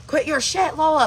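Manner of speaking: high, loud mock telling-off; joking scolding. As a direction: imitate a friend's playful scolding. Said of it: "like, how he does it, like, just joking around".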